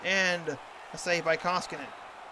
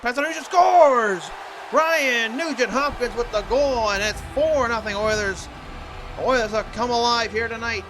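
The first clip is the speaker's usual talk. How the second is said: booming prize announcer; 4 semitones higher, 8 dB louder than usual. Real, not acted. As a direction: belt out the goal like a stadium announcer. Goal call in hockey commentary.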